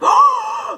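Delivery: sharp, very high sharp intake of breath; audience gasp. Retold or imitated. The audience reacting to the slate breaking, imitated sharp high gasp. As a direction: imitate a whole room gasping in shock. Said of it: "everyone just gasped".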